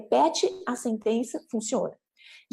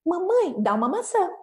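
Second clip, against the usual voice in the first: put-on higher child-directed model phrase; modelling a request; 8 semitones higher than usual. Shown as a demonstration. Parent gives Felipe the sentence to repeat, modelling. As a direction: give it clearly for a child to copy. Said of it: "você pode dizer o seguinte pra ele".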